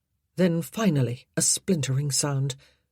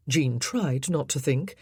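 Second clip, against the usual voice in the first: ordinary delivery, nothing marked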